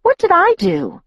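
high, sharp indignant question; indignant demand. A narrator's reading. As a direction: demand to know, offended. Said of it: "Alice demanded".

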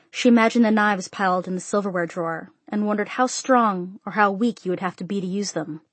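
plain reading voice

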